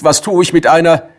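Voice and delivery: scolding. Acted. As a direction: complain crossly. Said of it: "habe ich geschimpft".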